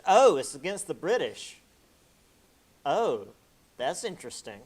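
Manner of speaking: loud, rising "Oh, it's against the British" as the penny drops; mock realization. The French catching on, in mock surprise.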